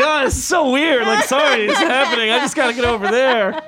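high-pitched, laughing fake apology; sing-song sorry while shoving through regardless; mock apology. Elbowing past people at a big show, mock-apologetic high voice.

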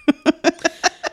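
bursting out laughing; a high laugh breaks out; high burst of laughter. Real, not acted. Laugh after spooky whisper.

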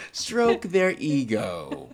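very slow "Stroke their ego", falling on EGO; amused, exaggerated downward "Stroke their ego"; downward inflection. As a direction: mimic it drawn out, dropping through "ego". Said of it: "Downward inflection".

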